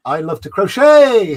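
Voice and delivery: loud, high, drawn-out name shout; exuberant callout. Acted. Streamer hailing a chatter by screen name, exuberant. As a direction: belt it out slowly with delight.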